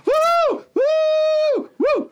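very high, drawn-out whoops; copycat woohoo. Retold or imitated. Imitating women joining the others, high whoops. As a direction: join in with a squealed "woo". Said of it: "så woohooer jeg også".

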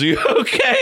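very high, panicked mock squeal; alarmed therapist. Therapist sees him collapse on the bed, mock high-pitched alarm. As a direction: squeal the question in exaggerated alarm.

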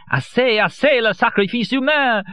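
carried away in rapture; transported. A narrator's reading.

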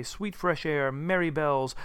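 plain reading voice

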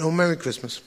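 ordinary talk

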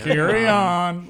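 slow, mock-authoritative carry on; mock police officer. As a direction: drawl it like a bored cop. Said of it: "I wanna say carry on more".

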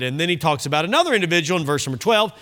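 ordinary delivery

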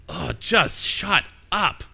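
slow, drawn-out fed-up groan of a line; mock Paul losing patience; disgusted Paul. Paul telling the spirit girl to shut up, slow and exasperated. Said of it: "being disgusted with her".

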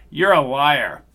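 the father hurls it at the agent; angry accusation. Acted.

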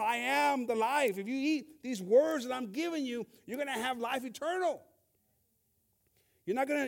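Jesus' words thundered high and loud; loud preaching. Acted. Preacher voicing Jesus to the disciples, booming and high. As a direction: proclaim it to the whole room at full force. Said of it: "He's telling them".

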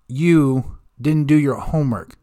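usual speaking voice

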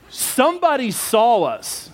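normal talking voice

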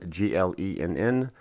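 normal talking voice

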